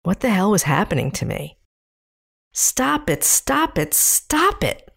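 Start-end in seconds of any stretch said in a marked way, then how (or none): 2.5-4.9 higher, insistent repeated scolding; scolding inner voice. Retold or imitated. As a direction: snap yourself out of it. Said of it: "said my inner voice".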